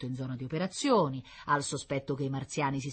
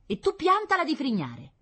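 high, loud, exasperated order; mother telling off a whining child; sharp scolding; 14 semitones higher, 6 dB louder than usual. A narrator's reading. Mother scolds crying daughter. (rimprovero secco e acuto)

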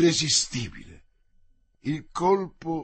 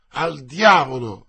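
defiant retort; 9 dB louder than usual. Acted. D'Artagnan defies hidden enemy.

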